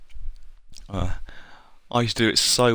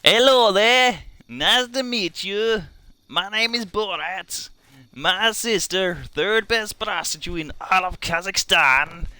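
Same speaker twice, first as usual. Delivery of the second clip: put-on high, drawn-out comic foreign accent; Borat; 8 semitones higher, 4 dB louder than usual. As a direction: put on a high-pitched caricature accent, slowly. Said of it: "if you want me to do Borat".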